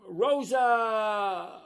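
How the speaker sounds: loud, high, drawn-out name call. Real, not acted. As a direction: holler the name, stretching it out. Calls out to a viewer by name.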